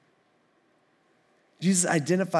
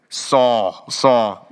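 low, booming, louder call of the name; deep voice of God; 6 semitones lower, 6 dB louder than usual. Shown as a demonstration. Preacher voicing Jesus calling Saul from heaven, deep and booming. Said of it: "Maybe more deep".

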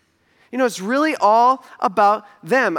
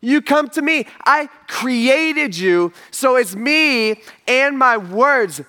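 slow emphatic Jesus; 5 semitones higher, 3 dB louder, slower than usual. Retold or imitated. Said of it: "He's like".

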